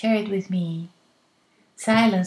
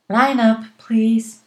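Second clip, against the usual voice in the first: calling out; 2 semitones higher, 8 dB louder, slower than usual. Shown as a demonstration. Teacher calls the class to line up.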